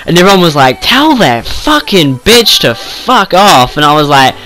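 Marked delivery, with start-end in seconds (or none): 0.8-3.8 slow, higher, drawn-out urging; imitated egging-on friends. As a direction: mimic mates egging you on, stretching each word. Voices friends egging him on.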